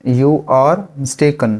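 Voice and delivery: drawn out slowly. Shown as a demonstration. Teacher models the sentence slowly. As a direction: say it slowly and clearly. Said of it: "इसको English में कहते हैं".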